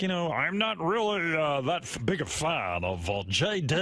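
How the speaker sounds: lightly voiced radio announcer; a hint of announcer delivery, drawn out at the end; Dan Patrick. Host imitates Dan on the panel, mock announcer voice. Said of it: "Dan's got an announcer's voice".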